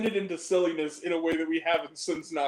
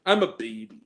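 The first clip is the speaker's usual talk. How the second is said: low mock baby; 10 semitones lower than usual.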